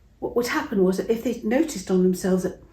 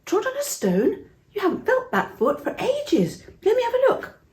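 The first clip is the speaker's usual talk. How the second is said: much higher, astonished questioning voice; amazed friend. Acted. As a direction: sound stunned by what your friend just said. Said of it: "He said".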